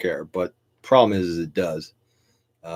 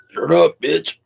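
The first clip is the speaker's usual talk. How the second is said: high, loud sudden outburst; sudden shout; 9 semitones higher, 7 dB louder, slower than usual. Real, not acted. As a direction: yell it abruptly, higher and louder. Breaks off to shout someone down.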